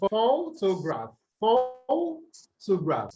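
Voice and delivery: photograph with PHO drawn out slowly; stress on first syllable. Shown as a demonstration. Teacher shows students where the stress falls, long first syllable. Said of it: "stress on the".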